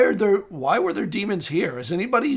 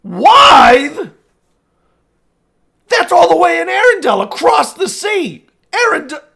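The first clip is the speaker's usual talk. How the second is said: high, loud shouting; outraged disbelief; 10 semitones higher, 8 dB louder than usual. Acted. Lord hearing he is across the sea, outraged. Lord appalled at distant location.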